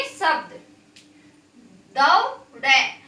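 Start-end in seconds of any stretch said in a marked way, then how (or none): 2.0-3.0 दौड़े stretched slowly, the औ held long; slow drawn-out demo. Shown as a demonstration. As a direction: sound out दौड़े slowly for the class. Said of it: "अब इसको मैंने दौ- दौ क्यों बोला".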